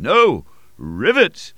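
can't believe his ears, burst out; high astonished exclamation; exclaiming. A narrator's reading.

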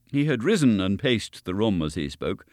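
ordinary delivery, nothing marked

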